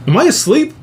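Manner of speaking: sudden high startled question; alarmed question. Retold or imitated. Dreamer startled, asking himself if he's asleep. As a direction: jolt up in alarm mid-thought.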